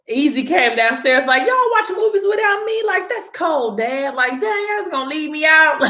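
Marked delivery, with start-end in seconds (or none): 1.5-5.8 put-on whiny kid brother. Easy complains about being left out.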